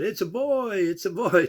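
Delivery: put-on high, loud proud cry of it's a boy; excited announcing. New father bursting with pride to coworkers, excited. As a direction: crow the news like a thrilled new dad.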